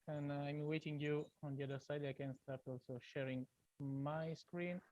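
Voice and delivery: faint, far from the microphone; barely audible. Real, not acted. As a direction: voice too low on the mic. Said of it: "your voice is very low".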